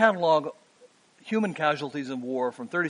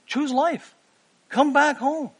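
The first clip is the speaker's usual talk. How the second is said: high, louder pleading invitation; pleading call; 9 semitones higher, 4 dB louder, slower than usual. Acted. Preacher voicing God calling Israel home, high and pleading. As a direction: call them home with open arms.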